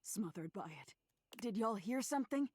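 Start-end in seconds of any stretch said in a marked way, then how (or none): 1.4-2.5 gruff voice. Acted. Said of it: "the gruff voice ask".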